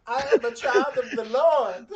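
high, slow, prissy falsetto with a laugh; mock pious church lady. Joking he's too holy for this, high mock falsetto. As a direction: play a scandalized holy woman, laughing.

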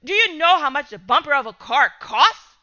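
loud, fast indignant rant; angry father. Acted. Father raging about the bumper cost, loud anger. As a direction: tear into him.

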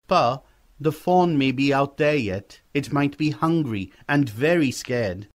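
put-on an earnest boy appealing to his father; pleading young boy.

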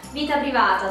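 ordinary talk

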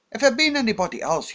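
fast, high, pressing argument; animated. A narrator's reading. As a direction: rush the point home. Hero arguing urgently.

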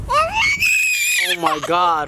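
very high, very slow drawn-out groan of despair; exasperated wail. Real, not acted. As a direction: stretch it out at the end of your rope. Baby won't stop crying.

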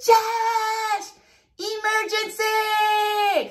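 high calling yell, emergency drawn out slow; yelling upstairs to Josh that it's an emergency; yelling for husband. Retold or imitated. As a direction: call upstairs for help, stretching it out. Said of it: "So I'm yelling".

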